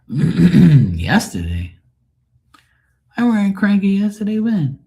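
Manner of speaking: high, loud, incredulous squeak; mock outrage. Real, not acted. Streamer to a chat viewer, in high, loud mock outrage. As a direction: act scandalised at the very idea. Protesting being called cranky.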